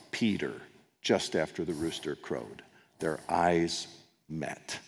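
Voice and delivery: soft, slow, solemn telling; hushed storytelling. A narrator's reading. Preacher telling Peter's grief, quiet and slow. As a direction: drop the voice for the sad climax.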